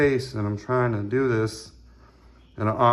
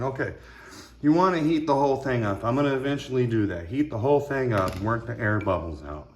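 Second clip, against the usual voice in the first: normal talking voice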